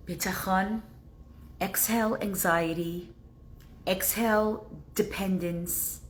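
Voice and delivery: soft, calm closing words; soothing blessing. Acted. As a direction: ease the listeners into calm.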